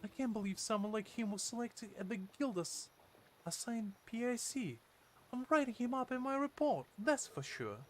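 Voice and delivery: high character voice. Acted. Same companion voice continues.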